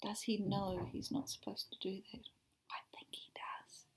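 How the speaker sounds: soft unvoiced whisper. Real, not acted. Teacher asking the students, in a whisper. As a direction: share it like a secret.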